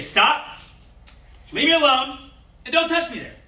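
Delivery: high, loud commanding shout; strong verbal self-defense shout; loud and firm. Shown as a demonstration. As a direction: yell it to make a stranger back off. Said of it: "you have to be loud and verbal with it".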